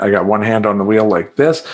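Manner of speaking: normal talking voice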